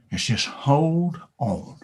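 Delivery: slow, choked-up 'hold on'; teary, slowed advice; tearful plea. Acted. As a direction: let the voice catch on the words. Cow's advice, emotional.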